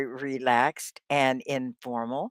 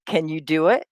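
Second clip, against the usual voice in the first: normal talking voice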